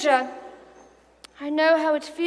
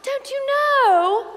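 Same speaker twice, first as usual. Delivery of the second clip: high, slow, sing-song fake surprise; gloating faux-innocent taunt; mock innocence; 9 semitones higher, 3 dB louder, slower than usual.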